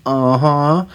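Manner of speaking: husband voicing his wife, mock sing-song yes; imitating wife's uh-huh. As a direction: mimic her cheerful agreement. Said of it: "She goes".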